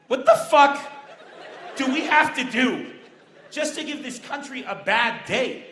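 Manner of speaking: loud, emphatic exasperated outburst; mock exasperation. ISIS viewer fed up with this country, mock exasperation.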